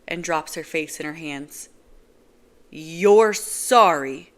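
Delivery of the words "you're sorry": very loud, slow incredulous yell; shouting. Acted. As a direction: throw her word back at her. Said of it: "I'm still shouting".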